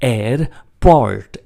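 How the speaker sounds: એરપોર્ટ stretched slowly, every sound clear; teacher slowing એરપોર્ટ for students; slow airport. Shown as a demonstration. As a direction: drag out એરપોર્ટ syllable by syllable. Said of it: "ઉચ્ચાર છે એરપોર્ટ".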